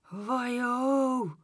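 high, drawn-out wailing siren; sustained high siren noise; imitated siren. A narrator's reading. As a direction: wail like a police motorbike. Imitates the police motorbike's noise.

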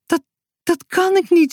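put-on a boy losing his nerve before the rescue; panicky whining. A narrator's reading. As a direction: whine in rising dread. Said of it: "Zo bleef hij nog een tijdje doorjammeren".

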